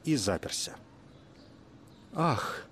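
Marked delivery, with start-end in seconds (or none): 2.1-2.6 high thin gasping ah; thin cry. A narrator's reading. Dragon faints at the sight of the princess, thin cry. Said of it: "тонко воскликнула рептилия".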